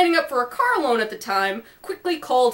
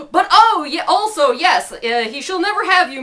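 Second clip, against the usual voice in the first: flustered heroic declaration; 5 semitones higher, 6 dB louder than usual. Acted. Harry's flustered hero speech.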